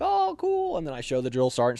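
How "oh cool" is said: mock impressed oh cool. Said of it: "They're like".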